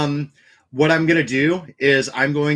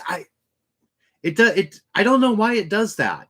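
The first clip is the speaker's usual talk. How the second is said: ordinary delivery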